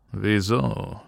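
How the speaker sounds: a curt question with a touch of growl. A narrator's reading. Booker answering the worried teacher, growling. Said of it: "knurrte Booker".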